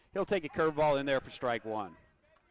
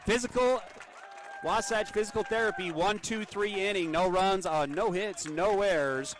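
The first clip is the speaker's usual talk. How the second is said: higher, brighter ad-read voice for the inning recap; sponsor read; 6 semitones higher than usual. Acted. Play-by-play announcer reading the sponsored inning recap, ad-read voice. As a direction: announce the sponsor and the line score.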